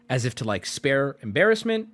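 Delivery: usual speaking voice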